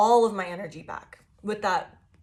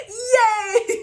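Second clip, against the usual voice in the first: shrieked cartoon yay, much higher and louder; a joyful cartoon shriek; over-the-top yay; 10 dB louder than usual. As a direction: leap out of bed with a squealing cheer. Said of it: "over the top about it".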